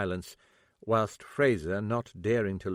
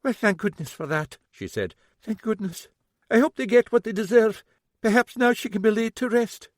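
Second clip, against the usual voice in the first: relieved lady; 12 semitones higher, 5 dB louder than usual. Acted. Fraser relieved.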